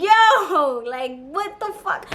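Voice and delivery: high drawn-out yo, fading into slow disbelief; retold astonishment. Acts out shock at huge hands.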